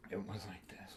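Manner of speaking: breathy hushed whisper showing the look. Shown as a demonstration. Comic showing the audience dad's look in a whisper. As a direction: whisper it like a chill. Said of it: "he gave me this look like this here".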